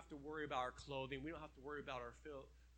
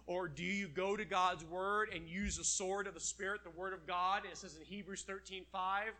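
usual speaking voice

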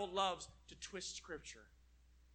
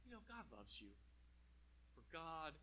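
soft, much quieter seductive murmur; devil whispering; 3 semitones lower, 19 dB quieter than usual. Acted. Devil whispers comfort to tempt us, soft murmur. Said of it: "Then he whispers to us".

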